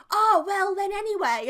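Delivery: loud, high, breezy carry-on; imitating oblivious grandma. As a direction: barge on loudly as grandma, regardless. Grandma carries on talking regardless.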